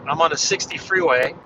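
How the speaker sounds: usual speaking voice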